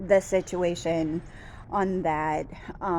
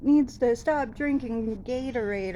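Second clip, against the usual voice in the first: emotional outburst; 6 semitones higher than usual. Retold or imitated. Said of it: "I actually got a little emotional".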